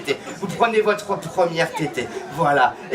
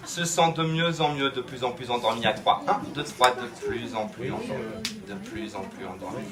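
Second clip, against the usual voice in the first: low, fading trance voice; hypnotic sleep induction; 8 semitones lower, 8 dB quieter than usual. Acted. Hypnotist lulls the touched person to sleep, fading hypnotic voice. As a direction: let each repetition sink softer and lower.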